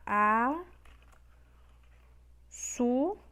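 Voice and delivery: "A", "su" as separate held syllables. Shown as a demonstration. Teacher separating a word for students, syllable by syllable. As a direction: break the word apart, long pause between "A" and "su". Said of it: "Vamos agora separar a palavra açúcar".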